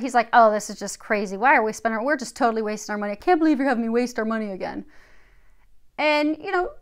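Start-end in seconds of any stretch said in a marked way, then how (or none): 0.3-4.8 louder, mock exasperated grumbling; critical complaining. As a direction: imitate a skeptic griping about waste. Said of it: "he was very critical of it".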